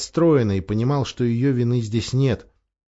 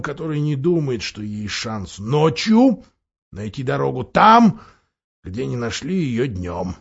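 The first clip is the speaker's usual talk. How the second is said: outspoken, loyal dwarf; 3 semitones higher than usual. A narrator's reading. Dwarf giving his honest opinion of invisible lions, emphatic scepticism. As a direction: declaim the dwarf's blunt opinion with big pitch leaps.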